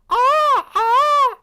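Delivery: loud, high peacock screech; peacock impression. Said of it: "Hence my peacock impression".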